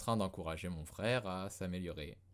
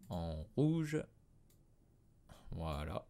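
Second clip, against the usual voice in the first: ordinary delivery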